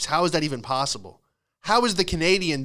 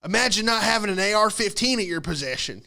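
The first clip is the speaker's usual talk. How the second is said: put-on higher-pitched good old boy retort; mock patriot comeback, pitched up; 6 semitones higher than usual. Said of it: "Some good old boys were like".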